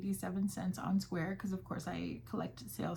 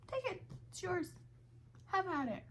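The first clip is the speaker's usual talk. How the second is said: high generous offer; 11 semitones higher, 3 dB quieter than usual. Retold or imitated. Her own generous reply.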